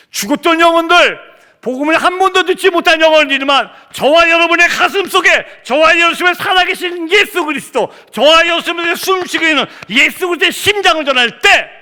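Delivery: high, loud preacher's proclamation; impassioned preaching. Real, not acted. Preacher proclaiming life to dead souls, loud impassioned shout. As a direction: preach at full force, pitch held high.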